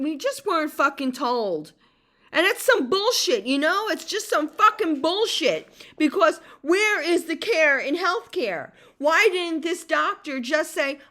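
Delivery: angry rant. Real, not acted. Ranting to listeners about the healthcare system, furious. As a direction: let the outrage boil over.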